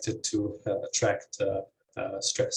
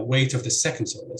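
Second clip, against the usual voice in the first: ordinary talk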